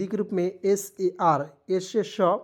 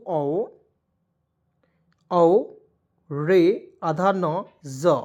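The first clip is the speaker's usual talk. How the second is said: रे आधा न ज dictated very slowly; slow spelling out; 3 semitones lower, much slower than usual. Shown as a demonstration. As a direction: dictate each piece slowly. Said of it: "O R A N G ई". (धीरे-धीरे लिखवाते हुए)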